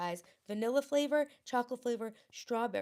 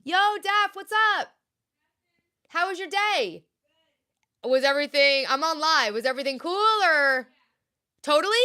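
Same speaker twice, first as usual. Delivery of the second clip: louder, higher, eager hellos; loud greeting; 4 semitones higher, 8 dB louder than usual. Real, not acted. Greeting daughter Daphne arriving home, loud and eager. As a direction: call out cheerfully to someone coming in.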